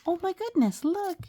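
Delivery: high delighted exclamation; surprised gasp. Real, not acted. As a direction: gasp and squeal at the page. Gasps at the picture.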